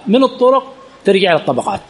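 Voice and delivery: ordinary talk, nothing marked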